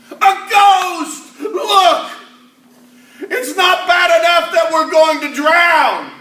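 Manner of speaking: high, loud terrified cries; frightened sailor screaming over the storm; panicked shouting. Acted. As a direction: yell in panic that they'll drown.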